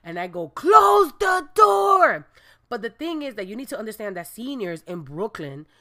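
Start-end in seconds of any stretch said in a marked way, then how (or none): 0.5-2.3 sudden high, loud, slow yell; shouted order. Retold or imitated. She yells at the senior to close the door, loud slow shout. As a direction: bellow it across the lobby.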